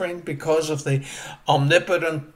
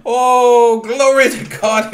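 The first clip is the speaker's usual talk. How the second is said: loud laughing cry of relief; joyful shout; 5 semitones higher, 9 dB louder, slower than usual. Retold or imitated. Preacher voicing the saved Irishman, laughing shout. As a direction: burst out with laughing joy. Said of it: "he shouted".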